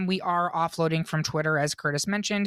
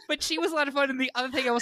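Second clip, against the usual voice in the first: spoken through a high laugh; giggling fondly; 7 semitones higher, much faster than usual. Real, not acted. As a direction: let the laugh carry the words. Still laughing after story.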